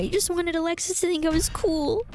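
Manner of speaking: put-on high, sorry little girl voice; teary young girl. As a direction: apologize like a guilty kid. Rosalina, teary-eyed.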